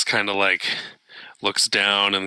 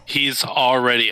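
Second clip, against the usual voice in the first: ordinary delivery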